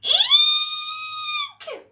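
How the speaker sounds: very high, loud shrieked eek; big lion letting out a tiny squeal; lion's eek. Acted. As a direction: squeal like a terrified mouse. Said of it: "let out an".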